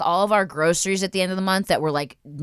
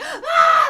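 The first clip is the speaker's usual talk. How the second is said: piercing high shriek with a laugh; terrified scream; 5 dB louder than usual. Retold or imitated. Woman fleeing the homeless woman, screaming. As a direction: scream while running away. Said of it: "And I'm like".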